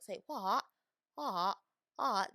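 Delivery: open-mouthed British "What", the final t crisp; country British accent. Shown as a demonstration. Practising the show's accent, country British "What". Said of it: "Okay, say it like this".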